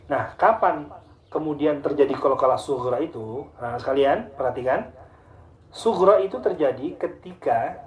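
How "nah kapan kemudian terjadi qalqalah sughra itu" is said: ordinary talk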